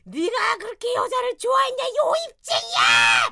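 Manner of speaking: high, loud enraged yell; furious shout. Retold or imitated. Wife screams at husband over the phone, furious high yell. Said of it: "막 소리치고".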